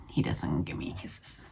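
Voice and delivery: low breathy whispered aside; whispering. Real, not acted. Aside about the dog.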